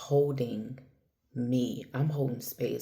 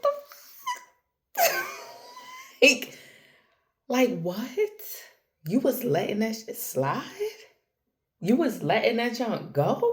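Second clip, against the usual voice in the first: much higher, laughing disbelief; incredulous; 10 semitones higher than usual. Retold or imitated. Scolding herself for letting things slide, high and incredulous. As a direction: scold yourself, half laughing.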